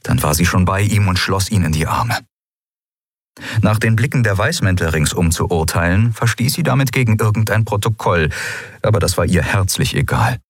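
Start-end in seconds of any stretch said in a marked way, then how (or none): none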